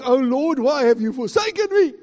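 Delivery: loud, high cry to heaven; anguished cry. Acted. Preacher crying out Christ's words from the cross.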